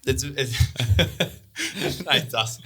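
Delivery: breaking into real laughter mid-sentence; laughing. Real, not acted. Guest laughing at his own purge mix-up, real laughter. Recalling a funny movie mix-up.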